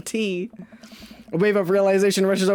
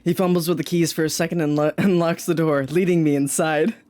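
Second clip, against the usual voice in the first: low, steady reading voice; story narration; 7 semitones lower than usual. Acted. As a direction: read it out as narration.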